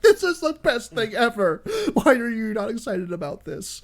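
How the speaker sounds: gush, baffled that they don't care; giddy, high self-imitation; excited fanboy. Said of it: "I'm like".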